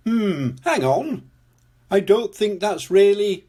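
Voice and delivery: object, pushing harder until cut off; displeased objection. Acted. Displeased Mister Elephant objects.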